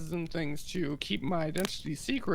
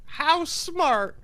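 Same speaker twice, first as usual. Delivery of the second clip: pleading; 8 semitones higher, 7 dB louder, slower than usual. Acted. Player pleading for beloved horse.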